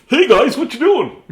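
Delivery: a cheery fish greeting the fisherman; chatty fish voice. Pretend. Fish pulled up on a hook, greeting the fisherman in a high cheery voice. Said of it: "he's like".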